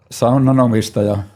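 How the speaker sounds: ordinary talk, nothing marked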